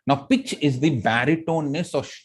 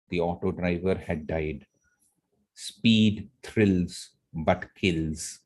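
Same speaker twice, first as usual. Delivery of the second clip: slow, soft and heavy for the tragedy; slow right down as the crash turns tragic; slow and somber; 6 semitones lower, 5 dB quieter, slower than usual. Shown as a demonstration. Teacher slowing down to show the tragic part. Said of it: "I had to go a little slow, slow, slow".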